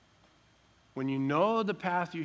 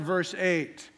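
ordinary talk

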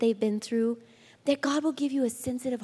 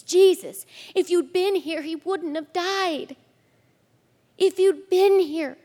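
distraught sisters; 6 semitones higher, 5 dB louder than usual. Acted. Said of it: "They're distraught".

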